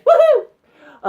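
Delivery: high, loud woohoo; excited whoop. Real, not acted. Cheering after sorting her messy hair, a high loud whoop. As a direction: whoop with delight.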